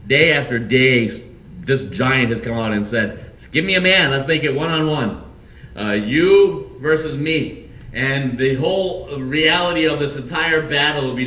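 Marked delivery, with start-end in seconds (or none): 3.5-7.6 giant's taunt. Acted. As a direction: throw down the dare like a boastful giant. Giant's daily challenge retold.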